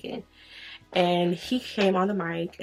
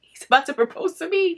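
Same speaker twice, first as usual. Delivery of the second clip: excited thought; 10 semitones higher, 4 dB louder than usual. Retold or imitated. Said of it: "'cause I'm like".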